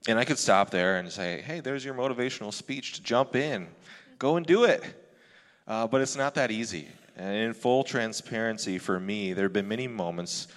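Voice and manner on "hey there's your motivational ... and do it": higher-pitched rallying call; motivational pep talk. Acted. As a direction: fire them up to jump in. Mock motivational speech to listeners.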